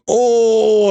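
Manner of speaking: high choked oh; a little fake sob sound, high up; mock tearful. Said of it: "And I'm like".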